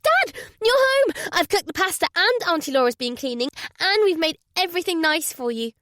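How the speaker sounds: put-on young daughter's excited burst; excited child. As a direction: gush with delight at dad's return. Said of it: "excited flurry of words".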